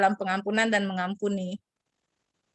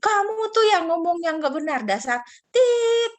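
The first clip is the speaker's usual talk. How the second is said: put-on higher, louder childish retort; mock quarrel; 9 semitones higher, 4 dB louder than usual. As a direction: fire the insult back, stretching the last words. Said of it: "Lalu dibalasin sama satunya".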